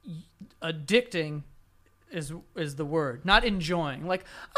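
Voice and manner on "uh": ordinary talk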